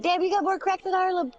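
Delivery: high, cheeky butt joke; joking quip. Retold or imitated. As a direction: pitch the joke up high and playful. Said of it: "I'm like".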